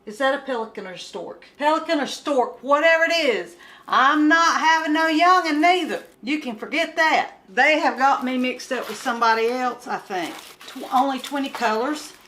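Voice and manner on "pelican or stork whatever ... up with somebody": loud, higher indignant outburst; real fed-up rant; mad. Real, not acted. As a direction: refuse it flatly, voice raised in annoyance. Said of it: "I'm mad".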